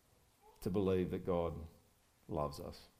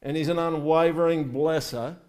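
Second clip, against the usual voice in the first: louder, rising fervent preaching; preaching at full force; 4 semitones higher, 5 dB louder than usual. Real, not acted. Pastor rallies his congregation, full fervent preaching. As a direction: lift the room, preach it out.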